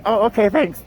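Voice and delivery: high, quick acted thanks; sheepish quoted reply. Crew member answering the yelling TSA agent, acted meek thanks. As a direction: re-enact a surprised, grateful little reply. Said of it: "And I'm like".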